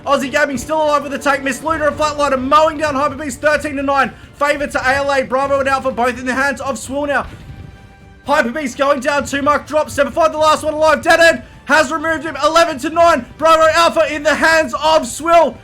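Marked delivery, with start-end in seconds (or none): none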